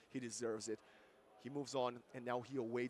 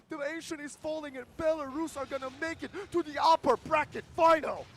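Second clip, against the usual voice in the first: much higher, much louder shouted casting; screaming play-by-play; 13 semitones higher, 16 dB louder than usual. Acted. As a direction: scream the final push like the game is ending.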